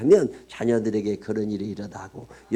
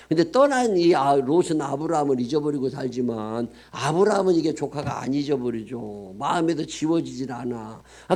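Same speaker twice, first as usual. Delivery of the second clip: ordinary talk, nothing marked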